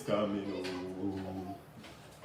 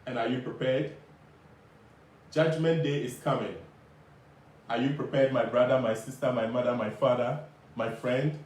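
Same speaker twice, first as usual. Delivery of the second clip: doomsday street preacher. Acted. Preaching the judgment message.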